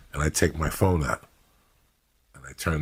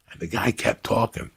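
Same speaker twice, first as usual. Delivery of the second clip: ordinary delivery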